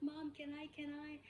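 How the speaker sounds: put-on a touch of an eager child; imitating pleading daughter. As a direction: lightly play a kid asking mom. Daughter voiced asking permission.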